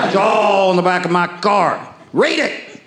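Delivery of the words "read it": smug taunt. Retold or imitated.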